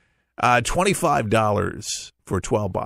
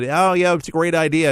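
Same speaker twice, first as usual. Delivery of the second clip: higher, breezy brush-off imitating careless listeners; mock dismissive; 6 semitones higher than usual. Host mimics people dismissing weather radios, mock breezy. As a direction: toss off "great" like people who shrug it off. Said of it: "they, they hear it".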